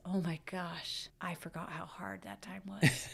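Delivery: hushed whisper, slowed down. Retold or imitated. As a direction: breathe it like a private aside from her future self. Future self voiced in thought.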